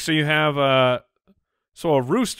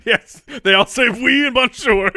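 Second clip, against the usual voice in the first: laughing; 8 semitones higher, 7 dB louder than usual. Real, not acted. Laughing joke about French.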